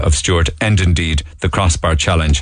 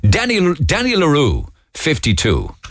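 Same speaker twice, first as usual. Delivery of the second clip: bingo caller; 8 semitones higher than usual. Pretend. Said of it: "I wouldn't mind doing a bingo call".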